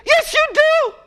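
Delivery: very high, sharp mock contradiction; indignant retort. Someone calling out an untrustworthy person, high and indignant. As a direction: shoot back at the liar, high and indignant.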